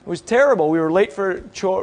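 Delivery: ordinary delivery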